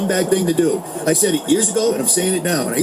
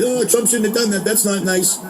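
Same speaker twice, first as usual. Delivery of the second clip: mock-prissy scolding, pitched higher; mocking Congress; 4 semitones higher than usual. Host mocking Congress members' weak scolding, higher mock whine. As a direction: sneer at their limp scolding of Trump. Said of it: "They say a little bit here".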